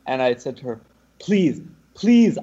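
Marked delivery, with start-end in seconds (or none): 1.2-2.4 pleading. Retold or imitated. Begging a stranger for taxi.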